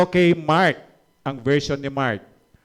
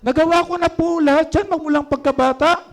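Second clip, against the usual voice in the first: put-on high, loud, self-satisfied young man; proud young ruler; 12 semitones higher, 6 dB louder than usual. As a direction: boast like the rich young ruler. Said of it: "Ano sagot ng binata?". (mayabang na binatang mayaman, mataas at malakas)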